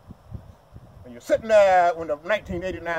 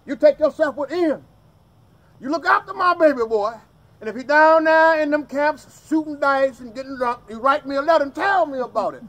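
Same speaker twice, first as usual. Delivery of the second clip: commanding mama; 5 semitones higher, 7 dB louder than usual. Retold or imitated. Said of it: "his mama done called on him, said".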